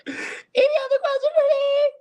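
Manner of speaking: very high squealed question; playful squeal. Pretend.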